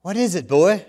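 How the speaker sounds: playful question to a dog; lightly teasing butcher; joking. Acted. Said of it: "the butcher asked jokingly".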